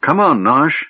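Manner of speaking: urging. A narrator's reading. Tas pressing the gnome to leave, urging. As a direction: urge a friend to get moving. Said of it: "Tas urged".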